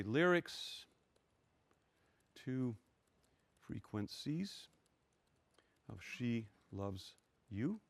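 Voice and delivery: slow, halting, word by word; absent-minded dictation to yourself with long gaps; saying while typing. Real, not acted. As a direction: murmur each word softly as you type it. Reading aloud while typing a command.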